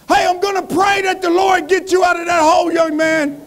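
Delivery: high-pitched shouting preacher; Baptist preacher. Acted. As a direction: proclaim it like a fired-up Baptist preacher. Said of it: "a Baptist preacher walked by".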